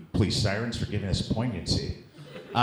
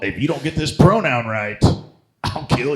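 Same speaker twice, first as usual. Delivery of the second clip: louder, higher playful menace; mock threat; 5 semitones higher, 10 dB louder than usual. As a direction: bark it as a fake threat.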